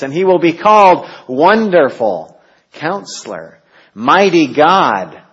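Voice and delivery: rising, proclaiming Isaiah recital; reciting prophecy. Acted. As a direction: build it with reverence to the last title.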